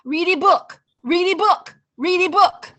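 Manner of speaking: put-on high, loud child's chant repeating the same request; small child pestering, loud and high; toddler nagging. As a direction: nag like a twenty-month-old who wants a story. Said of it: "the little one comes up".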